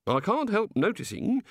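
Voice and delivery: puzzled politeness from a naive deity; curious, genial god. Acted. God remarking on the wizards' odd reactions, genially curious.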